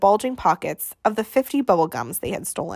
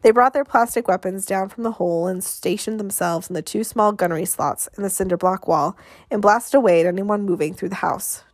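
ordinary delivery, nothing marked

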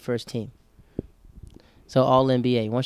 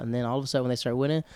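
ordinary delivery

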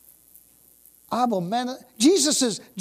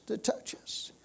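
whisper; 11 dB quieter than usual. Real, not acted. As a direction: hush it like holy ground. Preacher whispers reverently.

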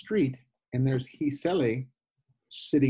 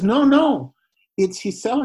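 insistent; 9 semitones higher, 8 dB louder than usual. Retold or imitated. Housemate insisting about phone call.

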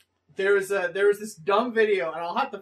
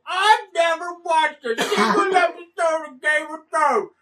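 mocking Facebook people; 8 semitones higher, 6 dB louder than usual. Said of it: "people are like".

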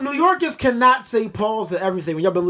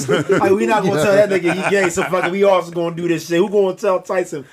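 ordinary delivery

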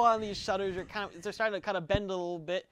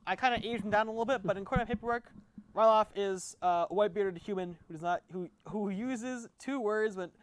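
ordinary talk

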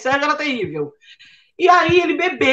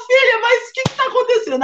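much higher, alarmed mom asking; worried mother; 10 semitones higher, faster than usual. Retold or imitated. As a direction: play the startled mother. Mother voiced in story.

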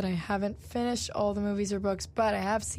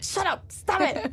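high, slow mock annoyed; 9 semitones higher, slower than usual. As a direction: act fed up and whiny. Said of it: "we literally were just like".

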